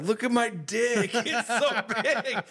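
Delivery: high, slow, laughing brag; mock boasting. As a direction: show it off giggling in a high voice. Retelling showing off in dream.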